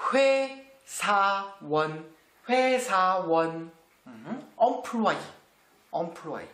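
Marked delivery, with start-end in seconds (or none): 0.1-3.7 회사원 sounded out syllable by syllable; pronunciation demo. Shown as a demonstration. Teacher showing learners how 회사원 is pronounced, careful syllable-by-syllable demo. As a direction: say 회사원 carefully in separated syllables, then repeat it. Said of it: "La prononciation".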